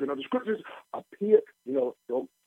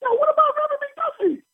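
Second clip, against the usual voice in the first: much higher, teasing voice; teasing high question. Retold or imitated. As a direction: pitch it way up to tease her. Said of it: "Mister D said".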